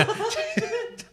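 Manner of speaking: high, squealing laugh; sudden high giggle; burst of laughter. Real, not acted. Laughs at teasing remark.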